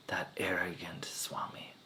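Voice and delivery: soft, breathy whisper of disdain; hushed contempt; whispered aside. Acted. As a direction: whisper it with scorn. Goddess mutters about the swami.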